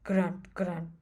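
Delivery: lightly voiced boar grunting; boar grunts. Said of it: "said Wild Boar".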